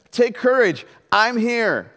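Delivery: loud, high call over the storm; reassuring shout across the water. Acted. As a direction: call out to calm frightened men. Jesus calling over the storm.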